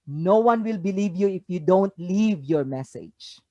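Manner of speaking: usual speaking voice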